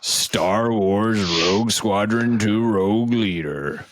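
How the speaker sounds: slow, drawn-out gala announcer. Acted. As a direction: announce each title like a trophy. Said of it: "It's like we're at the Game Awards".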